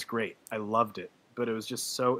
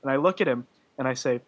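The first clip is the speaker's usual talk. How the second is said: ordinary talk, nothing marked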